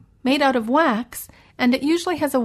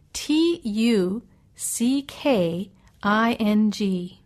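spelled out; much slower than usual. Shown as a demonstration. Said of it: "spells the word aloud".

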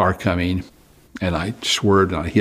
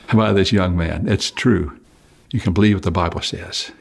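ordinary delivery

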